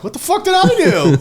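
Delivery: high, loud, clueless squeak; baffled high voice. Retold or imitated. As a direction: play the bewildered guy, laughing.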